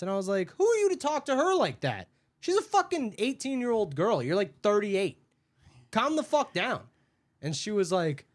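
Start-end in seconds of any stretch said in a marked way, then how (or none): 0.6-6.8 higher-pitched retold scolding; indignant telling-off. Worker scolding the customer who berated Haley, indignant. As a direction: stand up for a friend, mildly heated. Retold quarrel, defending coworker.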